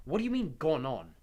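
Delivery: put-on quick, loud, high-pitched boy. A narrator's reading. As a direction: fire the question fast. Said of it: "said Harry quickly".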